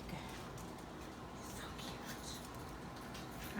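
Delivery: soft breathy aside; whispered. Real, not acted. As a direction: whisper it over the egg. Whispering over hatching egg.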